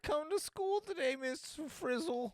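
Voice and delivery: very high, loud schoolkid voice; hammed-up regretful pupil, drawing out the name; whiny schoolkid impression. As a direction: lament to the teacher in a squeaky voice. Joking as Miss Frizzle's pupil.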